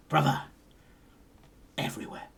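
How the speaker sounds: low, soft, drifting to a whisper; awed, secretive murmur; hushed reply. Acted. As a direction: whisper it with dramatic pauses. Dock worker answers dramatically, whispering.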